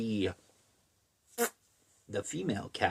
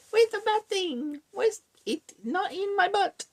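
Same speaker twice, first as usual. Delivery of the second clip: very high mock falsetto; silly girlfriend voice. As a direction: squeak it out as a cartoonish searcher. Voices searching girlfriend in story.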